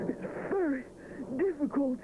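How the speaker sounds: high, strained, lightly puffing. A narrator's reading. Edward bearing Rupert's weight, mildly puffing. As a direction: sound like you're holding up weight. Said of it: "puffs Edward".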